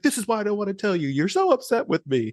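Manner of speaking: high, overwrought mock complaint; corny aggrieved actor. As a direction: whine it like a bad skit actor. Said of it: "it's like the corny type of thing".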